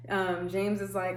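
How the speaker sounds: ordinary talk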